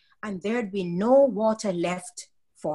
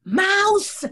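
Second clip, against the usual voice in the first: higher, louder playful yell; cry out; 8 semitones higher, 6 dB louder than usual. A narrator's reading. Ronald yelling behind Mama to startle her, loud. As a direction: shout it to make Mama jump. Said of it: "he would cry behind his mama".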